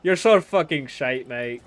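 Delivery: loud, slow, hammed-up Irish accent; a mock Irish insult, slow and loud. Streamer trying a bad Irish accent for chat. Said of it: "I was gonna do an Irish accent".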